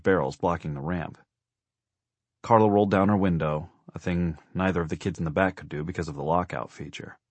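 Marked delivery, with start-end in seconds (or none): none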